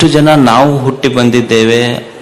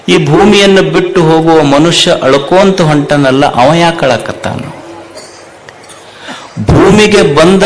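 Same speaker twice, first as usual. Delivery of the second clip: fervent preaching; 2 semitones higher, 3 dB louder than usual. Acted. Preacher builds to fervent pitch.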